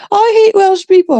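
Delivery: high-pitched mocking imitation; mimicked hateful outburst. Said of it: "I remember she said".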